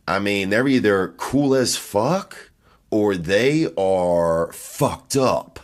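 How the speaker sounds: usual speaking voice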